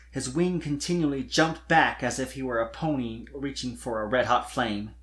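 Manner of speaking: ordinary delivery, nothing marked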